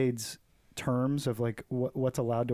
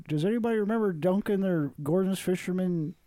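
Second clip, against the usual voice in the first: higher put-on voice for a nostalgic post; mock meme read-out; 6 semitones higher than usual. Old person's Facebook post asking who remembers, read in a higher put-on voice.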